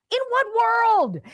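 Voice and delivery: high and slow, stretching what in disbelief; what world said high and drawn out, in disbelief; incredulous. Real, not acted. Host scoffing at the voting idea, high and slow. Host outraged at birthright perks.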